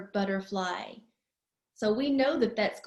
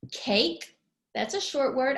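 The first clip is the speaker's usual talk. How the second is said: ordinary talk, nothing marked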